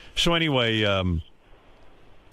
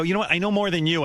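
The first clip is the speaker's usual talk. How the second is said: mildly indignant, higher retort; retold anger; 7 semitones higher, faster than usual. Son storms out on father, mild mock anger. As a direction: throw back a mock-angry retort. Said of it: "I got angry".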